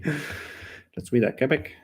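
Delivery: a slow, hammed-up Quebec French accent. Shown as a demonstration. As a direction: try the Quebec accent again, laying it on thick. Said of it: "that's probably terrible".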